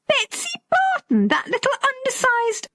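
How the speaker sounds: very high-pitched, astonished outburst; can't believe it's her; incredulous echo. A narrator's reading. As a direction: repeat the name in high disbelief. Daughter's astonished echo of the name.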